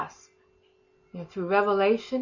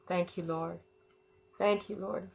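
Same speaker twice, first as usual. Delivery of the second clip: soft prayer; 7 dB quieter than usual. Real, not acted. Quiet prayer of thanks.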